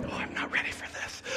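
whispered, fast panicked aside. Retold or imitated. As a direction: murmur it to yourself in a panic. Kid bursts into tears.

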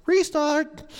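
high playground call of restart; kid calling restart. Retold or imitated. Pastor imitating a child on the playground, high call. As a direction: sing it out like a kid at recess. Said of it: "Like when your kid on the playground".

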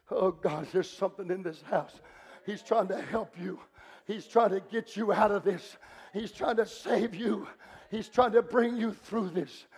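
low, hushed retold prayer; quiet, tender pleading; gentle reassurance. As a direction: soften right down and comfort with pauses between phrases. Retold tender moment over young lady.

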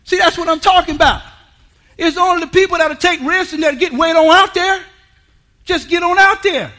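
loud, high preaching push; high, loud call to get out there; preaching at full force. Acted. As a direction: drive it home to the congregation. Preaching build.

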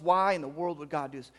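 usual speaking voice

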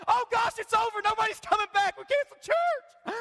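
high, loud, hammed-up panic; mock panic. Pastor mocking his own snow-day panic, hammed-up wail. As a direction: wail it like a drama queen. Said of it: "I'm kind of a drama queen".

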